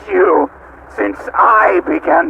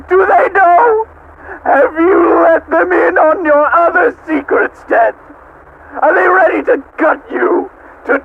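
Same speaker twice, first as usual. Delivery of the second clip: taunting shout; 10 semitones higher than usual. Acted. AM taunting Ted about his lies, shouting. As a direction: jeer it at him.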